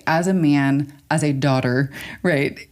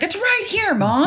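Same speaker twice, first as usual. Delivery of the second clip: high-pitched mock kid protest; put-on high voice of an impatient child; exasperated kid. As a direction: whine it up high to Mom. Kid answering mom, retold.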